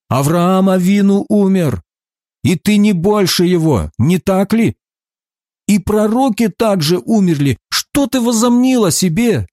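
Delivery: high-pitched, affronted questioning; indignant challenge. A narrator's reading. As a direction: build to an incredulous challenge, pitch climbing. Opponents challenge him in the dispute.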